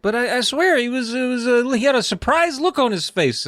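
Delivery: insisting. Retold or imitated. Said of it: "Joe's like".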